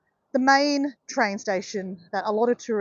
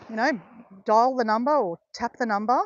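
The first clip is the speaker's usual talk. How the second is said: ordinary delivery, nothing marked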